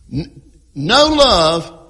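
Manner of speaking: slow, loud, heavy "no"; stress on no. Shown as a demonstration. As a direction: drag out and hit "no" in "no love". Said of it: "Emphasis on the word no".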